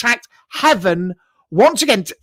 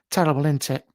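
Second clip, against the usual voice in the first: ordinary delivery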